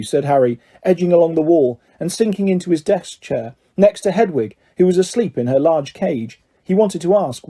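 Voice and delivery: plain reading voice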